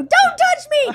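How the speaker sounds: high, loud panicked protest; retold screaming. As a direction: imitate a hurt kid yelling. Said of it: "So I was screaming".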